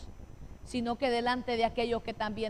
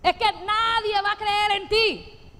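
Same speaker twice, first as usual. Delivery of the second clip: devil taunting; 9 semitones higher, 8 dB louder than usual. Acted. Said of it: "El diablo hablando".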